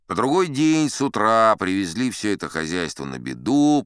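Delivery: usual speaking voice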